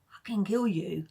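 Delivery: hushed swear word; whispered. Real, not acted. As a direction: drop to a whisper on the curse.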